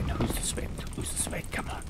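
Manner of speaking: unvoiced hushed whisper. Real, not acted. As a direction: whisper it sneakily. Repeats in whisper.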